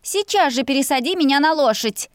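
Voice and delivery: high-pitched indignant order; cross, insistent command; angry bark. A narrator's reading. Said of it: "рявкнула я, гневно сопя".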